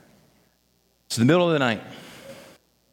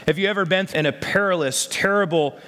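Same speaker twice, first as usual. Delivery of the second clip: usual speaking voice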